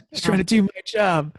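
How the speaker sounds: gruff tough cop; put-on cop voice. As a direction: say it like a movie cop.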